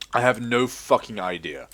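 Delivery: usual speaking voice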